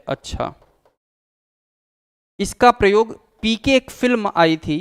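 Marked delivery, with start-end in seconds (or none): 0.1-0.5 low, soft, ominous अच्छा with a falling end; a hint of menace on अच्छा; threatening achha. Shown as a demonstration. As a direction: drop the voice into a quiet threat on अच्छा. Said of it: "और ज्यादा खींच करके कहेंगे".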